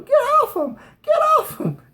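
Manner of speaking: high, loud panicked yell. Retold or imitated. Brothers yelling at the brute to get off. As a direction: shriek it like kids breaking up a fight. Kids yelling during a fight.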